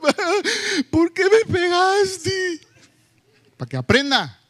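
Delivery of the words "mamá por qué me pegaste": put-on high, slow, whiny crying child's voice; mock the child's tearful complaint; hammed-up sobbing kid; imitating a crying child. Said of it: "comienzan a llorar y dicen".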